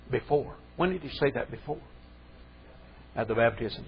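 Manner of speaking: ordinary talk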